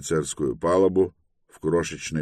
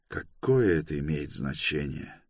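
usual speaking voice

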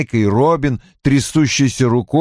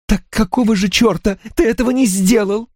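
high-pitched furious question; angry outcry; 11 semitones higher than usual. A narrator's reading. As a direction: cry out, demanding a reason. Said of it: "выкрикнула Анна". (гневный выкрик)

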